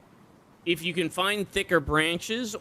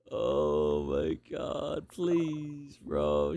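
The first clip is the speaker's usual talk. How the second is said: exasperated groan; 13 semitones lower, 5 dB quieter, much slower than usual. Real, not acted.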